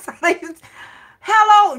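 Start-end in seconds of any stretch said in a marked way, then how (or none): 1.2-1.8 high, loud, stern mama's hello; mama answering. Retold or imitated. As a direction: boom out hello like an annoyed mother.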